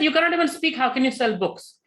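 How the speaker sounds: high, loud disbelief, you cannot even speak; mock outraged devotees, pitched high and loud; incredulous scoff.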